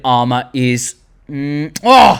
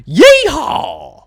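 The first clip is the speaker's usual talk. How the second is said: high loud cowboy whoop; cowboy yeehaw; 12 semitones higher, 5 dB louder than usual. Pretend. Cowboy call.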